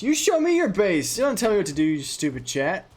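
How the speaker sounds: loud, high furious yell; angry shout. Acted. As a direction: explode at them. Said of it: "did you ever watch Arrested Development".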